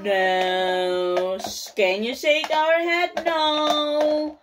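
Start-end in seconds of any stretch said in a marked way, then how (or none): none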